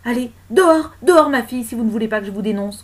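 imperious shout; commanding. Acted. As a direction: order her out sharply. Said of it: "commanda la dame".

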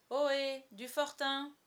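hearty shouted greeting; cordial hail. A narrator's reading. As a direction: hail the fort at full voice. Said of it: "crie-t-on".

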